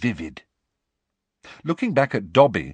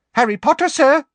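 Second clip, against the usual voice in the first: high, loud squeaky elf cry; 9 dB louder than usual. Acted. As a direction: squeak his name out. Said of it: "said a squeaky voice".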